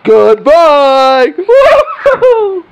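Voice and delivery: loud, high laughing cheer; laughing shout. Real, not acted. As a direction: cheer goodbye through laughter.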